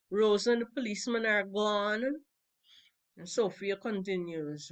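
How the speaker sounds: plain reading voice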